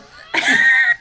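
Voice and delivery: loud helpless laughing fit; loud high laugh. Real, not acted. Cracking up at Greco-Roman.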